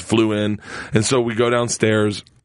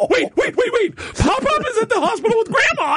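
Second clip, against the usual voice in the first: laughing through a quote. Real, not acted. Kids' realization retold while laughing.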